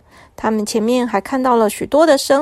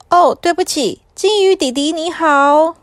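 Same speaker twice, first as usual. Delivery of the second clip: embarrassed; 5 semitones higher than usual. A narrator's reading. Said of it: "不好意思地说".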